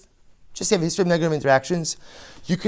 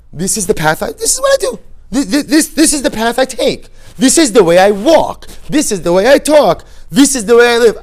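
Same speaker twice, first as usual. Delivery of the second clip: much higher and louder, laughing, in a proud stubborn sing-song; mock stubborn insistence; 7 semitones higher, 9 dB louder than usual. Preacher mocking everyone's insistence on their habitual path, laughing, hammed-up pride. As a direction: ham up the person who won't change his path.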